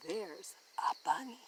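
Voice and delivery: hushed whisper, so as not to scare the bunny; breathy little whisper; whispered aside. Acted. Quiet whisper in woods.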